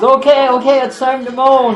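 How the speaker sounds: hammed-up announcement that it's time; mock cue to moan. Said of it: "he says".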